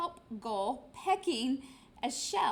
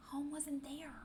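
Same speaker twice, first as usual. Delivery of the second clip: whispered dramatic reveal; hushed whisper; 2 semitones higher, 5 dB quieter than usual. A narrator's reading. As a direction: drop to a whisper for the shock. Dramatic reveal of lost home.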